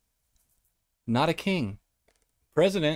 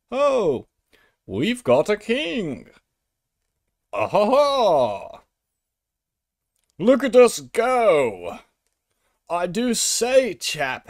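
jolly British monarchist; 3 semitones higher, slower than usual. Acted. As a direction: gush like an absurdly jolly British chap. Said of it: "I don't know why every British person".

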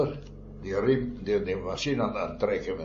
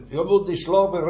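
higher and a little louder, calling out; mock shout to wake a coworker; retold shout; 9 semitones higher, 5 dB louder than usual. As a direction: call it out to a mate who isn't moving. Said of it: "riep maar".

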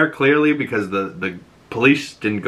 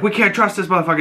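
suspicious wife voice; 8 semitones higher, much faster than usual. Retold or imitated. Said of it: "she's like".

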